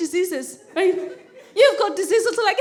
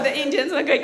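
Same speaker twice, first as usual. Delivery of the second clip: ordinary talk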